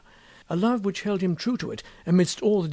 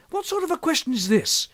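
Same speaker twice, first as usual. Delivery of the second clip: high, loud, offended protest; indignant anger; 4 semitones higher, 6 dB louder than usual. Acted. Kennedy angrily rebuking Berger for the question. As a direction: let the outrage build. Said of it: "glared angrily at his impassive companion".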